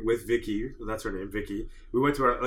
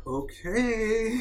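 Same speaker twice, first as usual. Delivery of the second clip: puzzled mechanic; 8 semitones higher than usual. Retold or imitated. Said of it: "just looked at me like".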